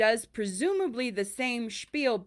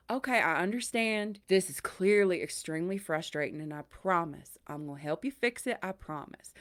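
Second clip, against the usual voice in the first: calming Southern accent; 3 semitones lower than usual. Shown as a demonstration. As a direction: talk an angry caller down in a gentle Southern accent. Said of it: "that Southern accent calms people down".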